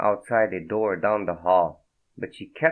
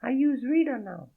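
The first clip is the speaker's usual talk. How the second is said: high, breathy-free French woman's voice, much higher; a startled French woman, voiced high; French accent. Naked woman startled by Fredo, in a high French accent. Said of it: "shy now".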